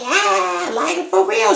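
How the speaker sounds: squealing high mock quote; imitating Sam running off, in a squeaky high voice. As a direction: pitch it way up, playing the fleeing woman. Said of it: "She was like".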